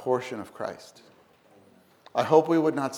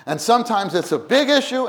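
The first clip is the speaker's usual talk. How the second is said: higher, louder on big issue; big issue stressed; 10 semitones higher, 6 dB louder than usual. Acted. Preacher to church, big issue sung out loud.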